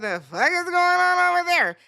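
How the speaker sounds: mock yell from next door; neighbors yelling. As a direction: call through the wall in alarm. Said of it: "my neighbors was like".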